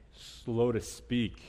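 very slow, word by word; slow answer. Shown as a demonstration. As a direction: drag it out for the church to repeat. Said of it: "slow to what, church?".

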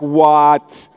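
loud, badly faked British accent; a hint of hammed-up British; mock British accent. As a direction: put on a clumsy British voice. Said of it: "there's a British accent involved".

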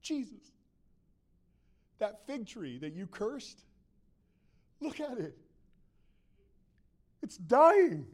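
lightly voiced astonishment, rising on the last words; disciple's startled discovery; amazed Peter. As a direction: point it out in halting disbelief. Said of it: "And Peter goes".

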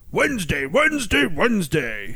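Wednesday, Wednesday, Wednesday! climbing then dropping; monster truck ad. Pretend. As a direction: pump it like a rally announcer. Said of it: "They should make it like".

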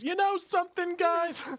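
very high squeaky impression; goofy high voice. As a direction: squeak it out like a dazed guy. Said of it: "he goes".

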